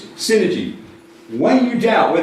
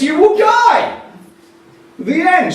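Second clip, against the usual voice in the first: much higher and louder, final; mock-dramatic declaration; dramatic punchline; 13 semitones higher, 6 dB louder, slower than usual. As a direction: slam the verdict home with a big lift.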